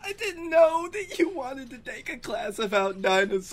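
high, squeezed out through laughing; real laughing fit, words riding high; helpless laughter. Real, not acted. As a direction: squeal it while cracking up. Cracking up at the friend's confession.

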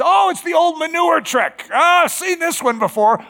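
loud, high, mock-amazed exclamation; knowing recognition. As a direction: call out that you've spotted the old trick. Acts out recognizing the enemy's trick.

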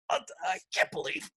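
high, loud, strained pain; acted agony. Actor auditioning while his arm is twisted, in agony. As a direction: play the line through mock agony. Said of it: "I'm sitting there in pain, literally".

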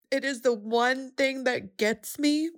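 fighting back tears, real emotion; choking up. Real, not acted. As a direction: voice breaking, near tears. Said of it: "this is the part I'm gonna cry".

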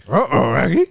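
a hint of the cartoon dog; lightly voiced Scooby; Scooby saying uh-oh; Scooby-Doo impression. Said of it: "do your Scooby-Doo impression".

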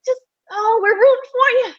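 high, teary, half-laughing encouragement; mock-tearful we're rooting for you; tearful laughing coach. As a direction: voice the coaches cheering her on through laughing tears. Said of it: "Kathy and team were probably thinking".

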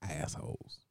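whispered under the breath; whispered insult. Real, not acted. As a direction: hiss it quietly to the friend. Muttered insult about gym.

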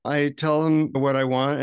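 ordinary delivery

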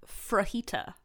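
frajita with an R slipped in after the F; mother's mispronunciation. Retold or imitated. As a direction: say frajita, fajita with an intrusive R. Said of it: "whenever she says a word wrong".

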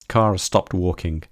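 plain reading voice

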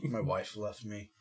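depressed man voice. Acted. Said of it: "like, depressed man".

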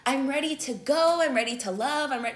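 mock the fake moment of being healed; mock naive self. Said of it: "then you're gonna be like".

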